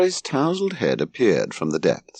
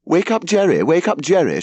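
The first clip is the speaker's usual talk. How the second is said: high, louder wake-up shout; urgent shout; 9 semitones higher, 5 dB louder than usual. A narrator's reading. Mother rousing Jerry during a fire, shouting urgently. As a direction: shout to rouse a sleeper, high-pitched. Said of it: "she shouted".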